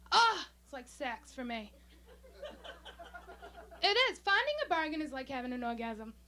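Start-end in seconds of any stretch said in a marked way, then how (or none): 0.1-0.5 sudden high, loud "ah" of pleasure; mock ecstatic cry. Bargain lover hearing of sales, mock ecstatic cry. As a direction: cry out in hammed-up bliss.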